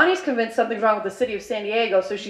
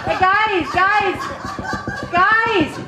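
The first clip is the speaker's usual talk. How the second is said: high, imploring retold call; 9 semitones higher, much slower than usual. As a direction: call for attention from the trunk. Forgotten friend calls from trunk.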